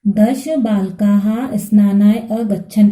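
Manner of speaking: दश बालकाः स्नानाय अगच्छन् read through in one slow, even phrase; read as one sentence. Shown as a demonstration. Teacher reading the full answer back to students, slow and whole. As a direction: join the words into one clear sentence. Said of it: "तो हम क्या लिखेंगे".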